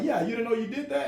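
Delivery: high, surprised question. Retold or imitated. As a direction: ask in disbelief. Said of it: "And they're like".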